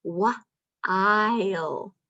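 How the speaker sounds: "Wa-ile" split into onset and rime; sounding out. Shown as a demonstration. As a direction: segment the word for the kids. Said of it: "Listen to the sounds".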